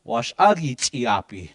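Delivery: "Wash agi chiapi" slowly with stress on "agi"; slow model. Shown as a demonstration. Teacher modelling the phrase slowly for learners. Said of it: "This is what we're saying".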